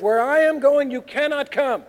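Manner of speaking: loud, high, forceful declaration; emphatic pronouncement. Acted.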